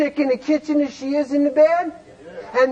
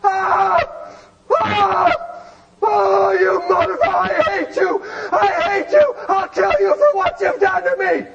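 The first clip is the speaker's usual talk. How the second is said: screaming in labor; 7 semitones higher than usual. Retold or imitated. Speaker acts out a wife in labor, screaming at her husband. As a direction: yell it like a woman in childbirth cursing her man. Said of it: "And she's screaming".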